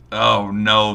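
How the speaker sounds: oh no. Real, not acted. Dreading the Bills-Jets game.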